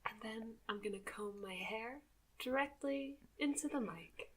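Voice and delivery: soft joking ASMR whisper. Pretend. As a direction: whisper it close to the mic. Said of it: "speak in a whispered tone".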